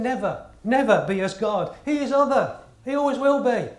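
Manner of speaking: rising declaration. Acted. Preacher rising to declare God other.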